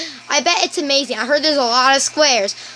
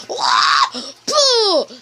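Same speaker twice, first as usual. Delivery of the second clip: silly Wither noise; 5 semitones higher than usual. Pretend. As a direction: make a goofy monster blast. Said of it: "And it just, like, goes".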